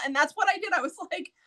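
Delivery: usual speaking voice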